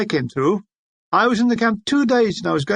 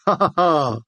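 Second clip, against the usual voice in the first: mocking laugh; 2 semitones lower than usual. Soldiers ragging Brockenbrough.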